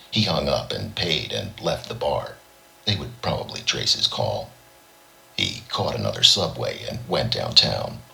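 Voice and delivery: plain reading voice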